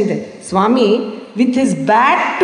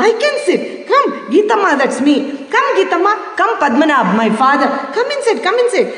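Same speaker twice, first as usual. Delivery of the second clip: high, warm calling to sit down; inviting Swami; 6 semitones higher than usual. Retold or imitated. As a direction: invite them in lifted.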